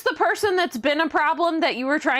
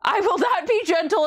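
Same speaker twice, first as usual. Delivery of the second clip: indignant shout; 8 semitones higher than usual. Acted. Mock outrage at a chat story.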